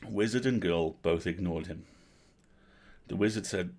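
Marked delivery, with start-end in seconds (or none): none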